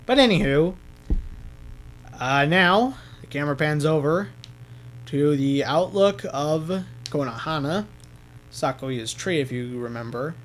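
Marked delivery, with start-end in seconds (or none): none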